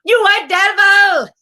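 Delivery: put-on high, slow, loud accented holler; mock yelling. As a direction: yell it as a hammed-up accusation. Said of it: "they was yelling".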